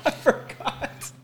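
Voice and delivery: breathy high whispered mimicry; mocking laughing whisper. Co-host mocks the line in whisper.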